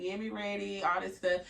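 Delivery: usual speaking voice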